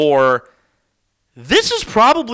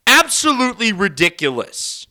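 ordinary talk, nothing marked

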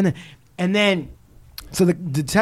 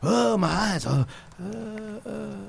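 hammed-up woozy moaning, fading softer; mock slipping into a trance, higher and quieter; falling under hypnosis; 5 semitones higher, 7 dB quieter, slower than usual. Suspect faking a hypnotic trance, mock-woozy. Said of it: "[name]'s falling under".

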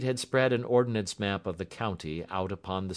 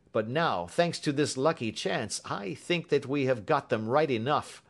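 high, animated inspector voice; raised, emphatic policeman voice; inspector recounting case; 9 semitones higher, 6 dB louder than usual. A narrator's reading. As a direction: report the chase with professional pride.

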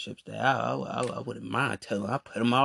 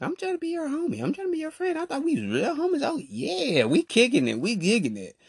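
much higher, fast whiny homie voice; high mocking self-quote; 14 semitones higher, faster than usual. To the fake homie, a much higher whiny quote. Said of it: "I'm sitting there like".

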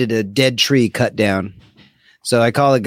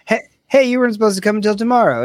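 high, quick startled objection; retelling surprise at the tree guy arriving early; surprised protest; 7 semitones higher, much faster than usual. Retold or imitated. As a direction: blurt it out, caught off guard.

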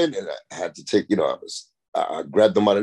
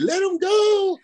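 high-pitched pleading yell, mimicking the crowd; high frantic crowd yell; 4 dB louder, slower than usual. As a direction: shriek it like a worried crowd. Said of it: "and everybody".

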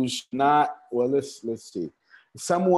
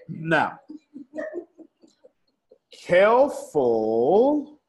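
drawn-out warning; 6 semitones higher, much slower than usual. Real, not acted. Warning the audience slowly.

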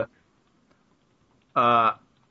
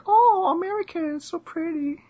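high-pitched mock British lady; British accent. Imagining British ladies reacting to an American visitor's accent, high mock British accent. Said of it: "Nice British accent there".

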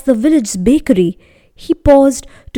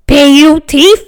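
angry baker; 4 semitones higher, 8 dB louder than usual. Acted.

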